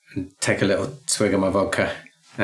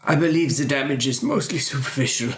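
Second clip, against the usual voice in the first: higher, mock-formal diagnosis; doctor character voice; 5 semitones higher than usual. Doctor player gives his own diagnosis, higher comic character voice. Same report repeated in character.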